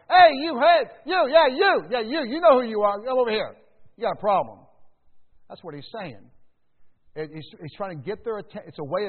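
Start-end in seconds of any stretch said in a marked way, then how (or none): 0.1-4.6 hollering to grab attention; mock yell. James calling out the boastful, loud mock yell. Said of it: "in a quiet, humble way".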